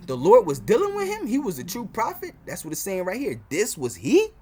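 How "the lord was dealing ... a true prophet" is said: imitating future believers. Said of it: "that's what you people gonna say".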